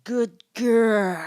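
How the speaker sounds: loud, slow hammed-up purr; mock seductive. Quoting Ezra's line to her co-host, mock seductive. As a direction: overdo the seductive praise.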